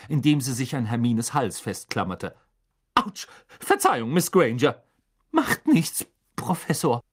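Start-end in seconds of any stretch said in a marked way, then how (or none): 2.9-4.8 stern teacher flustered. Acted. Teacher slips, apologises. 5.3-7.0 half-strangled, high and strained; choked. Acted. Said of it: "würgte Hermine".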